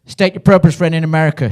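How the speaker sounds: put-on lightly done American border guard accent, stern. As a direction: bark it like an American border officer. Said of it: "She said it with an American accent".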